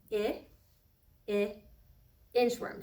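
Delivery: short "I" sound repeated, then "inchworm"; isolated short i sound. Shown as a demonstration. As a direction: stretch the short vowel "I, I" before "inchworm". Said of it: "We said that I says".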